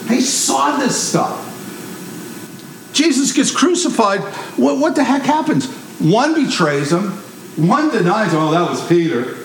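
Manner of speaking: usual speaking voice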